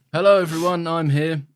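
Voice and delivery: loud, high, wooden actor voice; mocking Kit Harington. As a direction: ham up a bored wooden line delivery. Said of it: "bad voice acting, Kit Harington".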